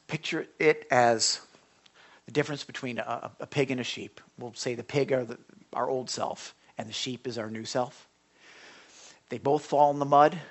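ordinary talk

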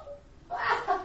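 high-pitched acted jeer; put-on scornful laugh; mocking laugh. Lawyer laughing at preaching girl, mocking. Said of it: "And he's mocking her".

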